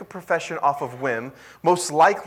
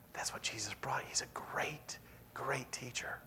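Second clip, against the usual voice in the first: hushed whisper; 16 dB quieter than usual. Acted. Preacher whispering to the congregation about Jesus, hushed awe. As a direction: drop to a reverent whisper.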